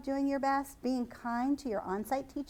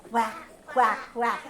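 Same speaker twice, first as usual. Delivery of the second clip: louder playful quacking; duck quack; 2 semitones lower, 4 dB louder than usual. Shown as a demonstration. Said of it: "What do the ducks say?".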